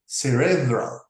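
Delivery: cerebral stressed on the second syllable, ce-RE-bral. Shown as a demonstration. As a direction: lean on RE in cerebral. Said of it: "accent on the second syllable".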